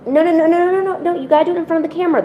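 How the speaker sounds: high, rapid string of no's; hurried protest. Real, not acted. Owner stops the cat taking treats off camera, high hurried protest.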